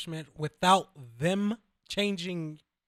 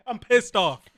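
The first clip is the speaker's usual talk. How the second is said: mock anger that breaks into laughter; can't keep a straight face playing the angry worker; laughing; 4 semitones higher, 3 dB louder, slower than usual. Cohost riffing on an angry cashier, laughing.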